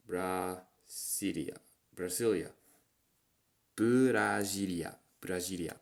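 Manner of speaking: Brasilia with Japanese vowels, some takes slow; Japanese pronunciation. Shown as a demonstration. Teacher repeating Brasilia for the class. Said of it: "what's the capital?".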